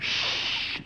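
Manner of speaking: sharp unvoiced shush; urgent whispered shh; hush. Real, not acted. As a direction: shush him so the audience can't hear. Producer hushing during play.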